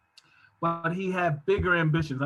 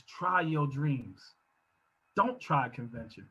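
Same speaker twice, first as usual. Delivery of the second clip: sincere; 4 semitones lower, 5 dB quieter, slower than usual. Acted. Quiet restatement of refrain.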